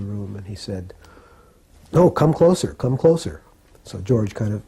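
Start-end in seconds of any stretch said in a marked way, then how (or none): 1.9-3.4 higher, louder acted-out urging; insistent beckoning. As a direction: call him nearer, as if retelling it. Acting Prabhupada beckoning George nearer.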